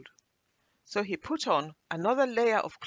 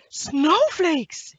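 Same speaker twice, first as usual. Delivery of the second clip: high, loud squeal of delight; thrilled storybook shout; excited scream; 12 semitones higher, 11 dB louder than usual. A narrator's reading. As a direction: cry out in amazement as an excited boy. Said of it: "he screamed, excited".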